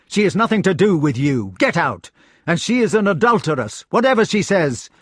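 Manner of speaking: angry knight. A narrator's reading. Knight tells Lancelot to stay out, angry. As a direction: order him off and accuse her.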